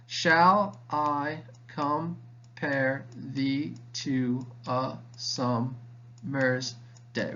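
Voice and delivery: very slow, each syllable separated. Shown as a demonstration. Teacher counting out ten syllables for students, syllable by syllable. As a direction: spell out the line in evenly spaced syllables.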